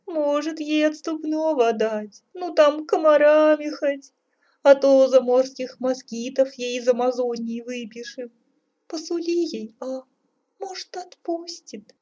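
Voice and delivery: very high, whining tearful plea. A narrator's reading. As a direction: bargain in a high weepy voice. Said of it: "заплакала царица". (жалобно, плачущим высоким голосом)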